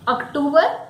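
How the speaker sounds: OCTOBER as uk-TOO-ber, rising; wrong pronunciation. Shown as a demonstration. Teacher quizzing learners, wrong pronunciation. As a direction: say OCTOBER the Hindi way, as a question. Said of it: "No, it is October".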